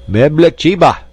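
loud, high foreign-sounding shout. A narrator's reading. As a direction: bark the strange words out loud and high.

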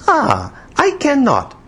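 put-on very high, drawn-out girlish pout. A narrator's reading. Woman failing to pronounce his name, pouting. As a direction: whine it slowly, foreign-accented and sulky. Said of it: "she said poutingly".

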